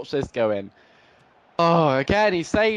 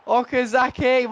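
high excited name with the voice breaking; voice cracking; 8 semitones higher than usual. Real, not acted. Gamer hailing his scorer, voice cracking high. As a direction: call the scorer as your voice gives out. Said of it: "my voice just went".

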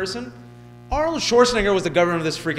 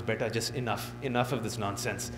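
stern uncle, lower and softer; 6 semitones lower, 8 dB quieter than usual. Retold or imitated. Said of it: "He goes".

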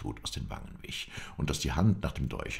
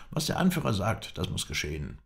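high, emphatic assertion of authority; commanding; 6 semitones higher, 3 dB louder than usual. A narrator's reading. New leader insists on obedience, high and commanding. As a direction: lay down the law as the leader.

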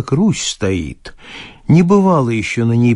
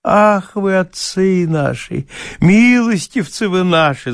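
higher, sing-song bailiff gushing; sing-song fawning; 6 semitones higher than usual. Acted. Bailiff greets his master in a sing-song voice. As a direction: croon it like a tipsy fawning peasant. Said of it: "заговорил он нараспев и с таким умилением".

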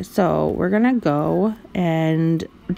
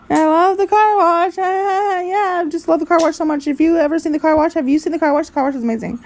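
sarcastic gush; 7 semitones higher than usual. Pretend. Sarcastic praise of car wash.

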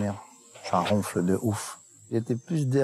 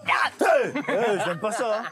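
cri de frayeur nerveuse; 12 semitones higher, slower than usual. Real, not acted. Bête bizarre dans l'arbre, la nuit.

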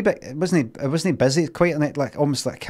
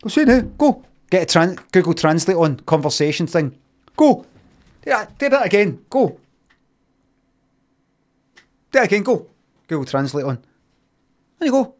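insistent demand; 3 semitones higher than usual. Retold or imitated. Acts out ordering him to repeat it.

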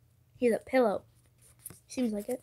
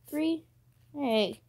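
ordinary talk, nothing marked

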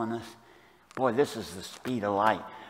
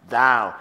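"thou" hit loud and sudden; abrupt, emphatic; 10 dB louder than usual. Shown as a demonstration. Preacher showing the Hebrew emphasis, "thou" abrupt and loud. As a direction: punch "thou" abruptly, with force. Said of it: "it's abrupt. In the Hebrew, it's abrupt. It's emphatic".

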